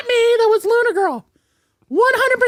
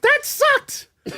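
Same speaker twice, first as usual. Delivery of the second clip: higher, exasperated mock yelling; mock scolding; 6 semitones higher, 3 dB louder, slower than usual. Heckling the show for laughs, mock scolding. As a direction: playfully demand better, ending in a yell.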